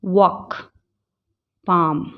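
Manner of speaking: usual speaking voice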